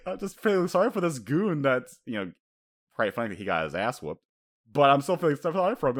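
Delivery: ordinary delivery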